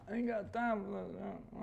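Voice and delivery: high, sassy, soft aside; sassy put-on voice. Owner mocking her own reluctance, high and sassy.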